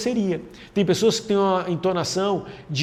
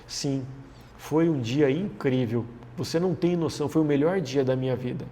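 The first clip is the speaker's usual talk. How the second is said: subdued, softer and lower mock storyteller; unenthusiastic storytelling; 3 semitones lower, 5 dB quieter than usual. Someone telling you an incredible story, unenthusiastic and subdued. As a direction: pretend to rave with no enthusiasm at all. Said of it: "contar uma história incrível pra você, mas só que ela conta".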